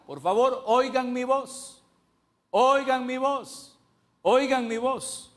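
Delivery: high, slow, loud pleading as God. Acted. The Lord begging his people to hear him, loud high pleading. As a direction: stretch each oigan mi voz in a high loud plea.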